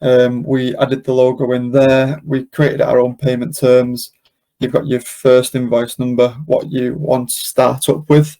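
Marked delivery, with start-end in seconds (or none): none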